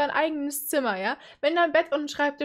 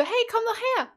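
mock teenager calling; 9 semitones higher than usual.

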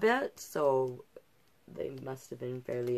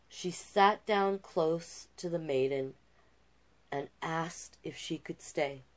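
gentle rhyming read-aloud; storyteller voice for a picture book; storybook narration; 2 semitones higher than usual. A narrator's reading. Reads the fairy tale aloud.

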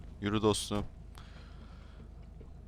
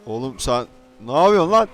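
annoyed yelling; 2 semitones higher, 10 dB louder than usual. Acted. Confronting caller on phone.

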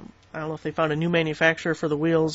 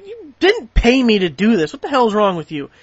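sharp, louder mock outrage; retold angry retort; 3 semitones higher, 5 dB louder than usual. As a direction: snap back at the company in a put-on voice. Retelling his angry reply.

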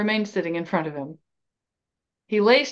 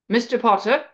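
higher, commanding call of a name; mildly imperious summons from behind; 4 semitones higher, 4 dB louder than usual. A narrator's reading. Professor halting two boys from behind, imperious. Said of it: "a voice said imperiously from behind them".

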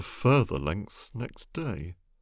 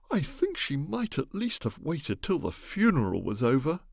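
scandalised; 8 semitones higher than usual. Acted. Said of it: "in a scandalised voice".

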